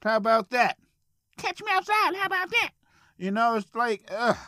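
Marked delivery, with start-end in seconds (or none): 1.4-2.7 high put-on taunting voice; imitating a street taunt, pitched way up; mock taunt. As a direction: throw the challenge in a squeaky mocking voice. Quoting a taunt meme.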